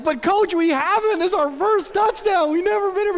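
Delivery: very high, loud, frantic pleading; excited boyish protest. Retold or imitated. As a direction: protest to the coach like a thrilled kid. Boy protests to coach, overexcited.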